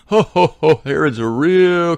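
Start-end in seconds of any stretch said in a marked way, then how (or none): 0.1-0.8 loud, high, hammed-up ho ho ho; mock hearty laugh. Preacher imitating the audience laughing at Herod, with a loud mock laugh. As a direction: belt out a fake jolly laugh.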